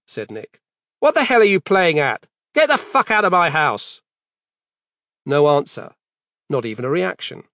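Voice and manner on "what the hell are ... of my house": loud, high angry yell; angry shout. Acted. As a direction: bark him out. Confronting intruder in kitchen.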